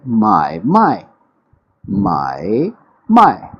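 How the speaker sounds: trading: buy then sell tones; 买卖 alternating; mai mai alternating tones. Shown as a demonstration. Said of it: "इन दोनों को इकट्ठा बोल दें".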